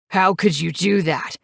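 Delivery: a touch of angry accusation; yelling. A narrator's reading. Knives confronting Mary across the table, mild angry yell. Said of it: "he yells at me".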